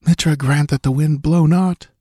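ordinary delivery, nothing marked

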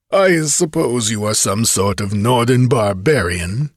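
coarse, boastful tavern braggart; drunken bragging rogue. A narrator's reading. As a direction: gloat like a drunk kidnapper. Kothian mocks the stranger.

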